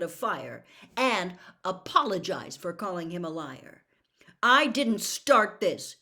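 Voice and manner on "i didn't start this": loud, slow, stubborn insistence; sulky boy insisting it isn't his fault; stubbornly. Acted. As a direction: dig your heels in and say it hard. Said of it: "Harry said stubbornly".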